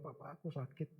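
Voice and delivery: softly voiced sick daughter; a hint of a child in pain, hushed; lightly voiced daughter pleading softly to her father; hurting child. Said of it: "sakitnya dia bilang".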